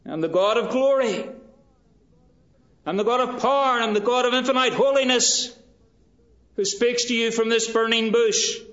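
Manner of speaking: loud, high, soaring declaration; voicing God. God answering Moses from the burning bush, loud and high. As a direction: thunder it out as the God of glory.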